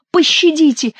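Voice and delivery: high, pleading cry; babbling plea. Acted. Monk feeling the blows, high babbling plea. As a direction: whimper for mercy. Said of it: "лепетал Горанфло".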